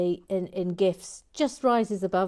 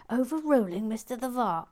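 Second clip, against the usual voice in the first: higher, louder mock shout; shouted quote; 6 semitones higher, 3 dB louder than usual. Host acting out a viewer's shouted ruling, raised mock shout. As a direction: call it out in a raised voice. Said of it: "Then he shouts".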